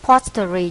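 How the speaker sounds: "Pottery" stressed hard on the first syllable. Shown as a demonstration. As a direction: hit POT- loudly in "Pottery". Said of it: "nhấn âm một".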